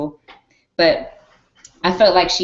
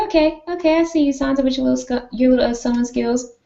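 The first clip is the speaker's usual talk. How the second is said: mock sarcastic; 7 semitones higher, faster than usual. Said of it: "So I said".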